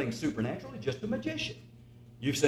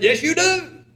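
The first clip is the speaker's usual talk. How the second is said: loud, high gravelly old preacher imitation; emphatic, raised-pitch impression of an old preacher; Brother Miller; 14 semitones higher, 8 dB louder, slower than usual. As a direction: answer firmly in the mimicked gravelly voice. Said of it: "I do a fair imitation of him".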